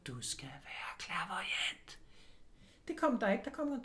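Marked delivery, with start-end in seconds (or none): 0.1-2.0 slow breathy whisper. Retold or imitated. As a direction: whisper it like a secret message. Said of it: "der blev hvisket".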